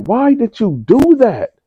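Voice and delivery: mock a shrieking scandalised mother; outraged mother. Mother confronting son.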